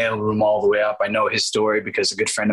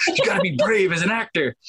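high, comic self-lecture; arguing with himself; 9 semitones higher than usual. Retold or imitated. Actor telling himself off after a timid walk-off, mock scolding. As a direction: pitch up and mock-lecture yourself. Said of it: "I'm, like, arguing with myself".

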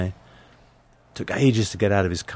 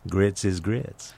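Southern waitress; 3 dB quieter, much slower than usual. Retold or imitated. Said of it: "And then she just said".

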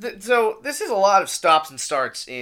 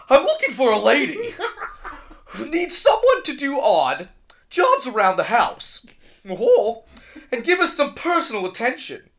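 higher, louder cartoon octopus chef; 6 semitones higher, 5 dB louder than usual. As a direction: read the personal ad as a fussy cartoon octopus chef. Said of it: "doing the octopus chef voice".

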